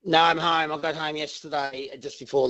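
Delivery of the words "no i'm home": usual speaking voice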